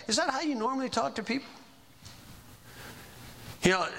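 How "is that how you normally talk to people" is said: higher, quick polite question; lightly demonstrated polite challenge; polite call-out. Shown as a demonstration. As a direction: call them on it nicely, pitched up and brisk. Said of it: "in a polite way".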